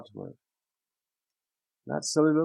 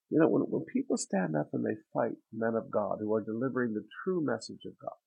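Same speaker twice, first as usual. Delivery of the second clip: ordinary delivery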